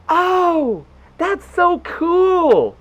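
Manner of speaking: high falsetto, drawn-out gush; delighted girlfriend. Retold or imitated. Girlfriend reacting to his website, high-pitched mock delight. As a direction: coo it slowly in imitated delight.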